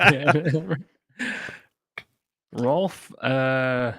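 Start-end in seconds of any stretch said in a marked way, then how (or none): 0.0-0.8 blurted through a laugh. Real, not acted. Cohost suggests a silly dwarf name, laughing.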